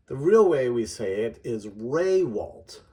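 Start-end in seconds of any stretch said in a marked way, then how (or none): 1.7-2.8 Raywalt said "RAY-walt", first syllable stressed; correct surname. Shown as a demonstration. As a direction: Raywalt with a long "ay" up front. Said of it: "The emphasis is on the first syllable".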